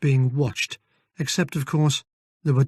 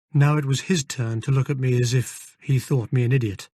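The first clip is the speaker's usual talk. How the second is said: plain reading voice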